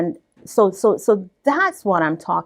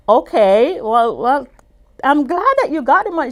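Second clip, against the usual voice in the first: loud, high, fast burst of joy; excited; 7 semitones higher, 7 dB louder than usual. Retold or imitated. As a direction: blurt it out thrilled and happy. Retold excited reply to client.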